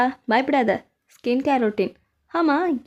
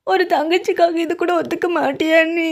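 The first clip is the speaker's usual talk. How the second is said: fake crying plea; 4 semitones higher, 4 dB louder than usual. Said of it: "எதுக்கு இப்ப அழுது காமிக்கிற".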